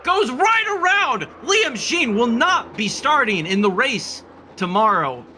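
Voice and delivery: stunned commentary. Real, not acted. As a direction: call the spin in disbelief. Reacting to the crash.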